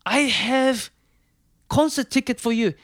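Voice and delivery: put-on thrilled Italian mechanic in Italian accent. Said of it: "and he says".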